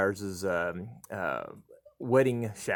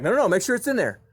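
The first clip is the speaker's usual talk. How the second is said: higher, louder insisting; urging dad; 11 semitones higher, 5 dB louder than usual. Retold or imitated. Dad urging his son to check the DVD. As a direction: push him to check again. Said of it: "And I was like".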